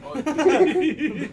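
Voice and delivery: shouted over-pronounced oatmeal; loud laughing correction. Shown as a demonstration. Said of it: "Did you say ointment or oatmeal?".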